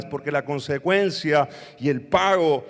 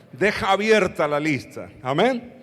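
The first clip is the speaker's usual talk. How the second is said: ordinary delivery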